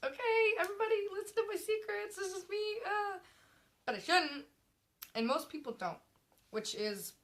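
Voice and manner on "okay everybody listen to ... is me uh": high, fast mock announcement; oversharing poster voice. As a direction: squeal it like an eager oversharer. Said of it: "because I'm like".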